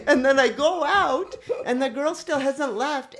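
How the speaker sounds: laughing while going on with the story; still laughing. Real, not acted. Telling friends what happened next, still laughing.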